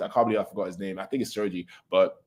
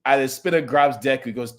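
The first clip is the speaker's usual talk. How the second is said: normal talking voice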